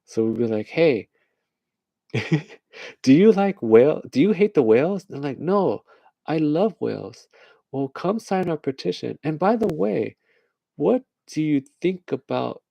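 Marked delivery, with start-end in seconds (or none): 0.7-5.0 high, louder hail to passersby; mock petition canvasser. Petitioner hailing strangers outside, mock canvassing. 5.4-7.3 high-pitched indignant protest, played up; mock earnest passerby. Passerby denying hatred of whales, mock earnest. 7.7-12.6 high, coaxing sales patter; mock petition canvasser. Petitioner feeding a signer a line, mock coaxing.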